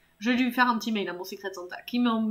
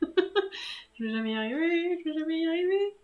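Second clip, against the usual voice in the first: giggly, high-pitched moaning; laughing despair; 5 semitones higher than usual. Real, not acted. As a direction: wail helplessly, laughing. Despairs while laughing at the game.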